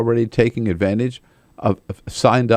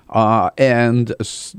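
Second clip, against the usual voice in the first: usual speaking voice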